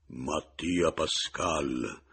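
very slow, hollow, booming voice; drawn-out sepulchral announcement. A narrator's reading. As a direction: sink the voice into a cavern. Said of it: "incavernando ancor più la voce".

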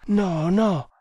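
frightened. A narrator's reading. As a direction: cry out no in fright. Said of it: "esclamò la giovanetta con spavento". (spaventata, con voce più acuta)

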